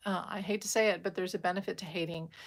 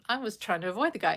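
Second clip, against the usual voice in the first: ordinary talk, nothing marked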